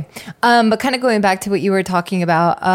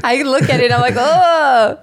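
disgusted oh through laughter; laughing; 5 semitones higher, 3 dB louder than usual. Real, not acted. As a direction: laugh out a grossed-out oh. Reacting to chapped lips.

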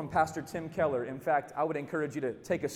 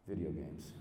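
ashamed low confession; 11 semitones lower, 8 dB quieter than usual. Retold or imitated.

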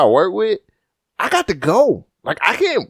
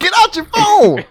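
high, loud, drawn-out holler; sudden shout; 4 dB louder, slower than usual. Real, not acted. Interrupting a friend over the phone, shouted high and loud. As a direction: holler it to cut in on them. Interrupts to scold someone mid-talk.

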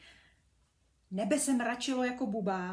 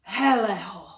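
loud excited cry, much louder; shout; 14 dB louder than usual. Acted. Brunda shouts on spotting the snail shell, loud. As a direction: call out the find loudly. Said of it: "křikl".

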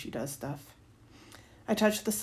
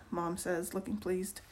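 usual speaking voice

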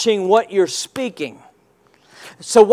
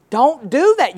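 ordinary talk, nothing marked

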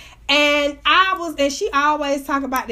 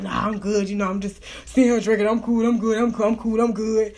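low, repetitive imitation of a man declining; uneasy boyfriend; 5 semitones lower than usual. Baby daddy sitting there drinking, low mock-cool reassurance. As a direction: lower the voice, mock his insistent reassurances. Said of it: "he looking crazy as hell".

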